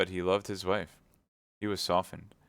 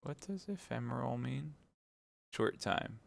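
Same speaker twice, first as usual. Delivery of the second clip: soft aside to a listener; quiet aside; 5 semitones higher, 8 dB quieter than usual. Real, not acted. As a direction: drop out of the story quietly. Reader aside explaining a word.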